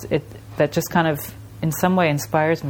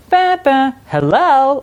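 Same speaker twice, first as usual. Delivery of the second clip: high, loud sing-song chime; imitated ice cream truck chime; 8 semitones higher, 6 dB louder, slower than usual. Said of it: "the one that goes like".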